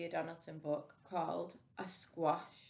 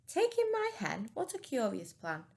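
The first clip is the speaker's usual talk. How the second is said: incredulous echo; 7 semitones higher than usual. Acted. Old lady repeating the advice back in disbelief.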